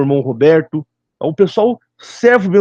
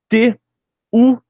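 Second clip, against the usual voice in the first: normal talking voice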